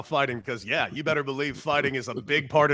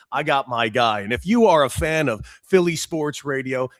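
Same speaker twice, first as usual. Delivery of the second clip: usual speaking voice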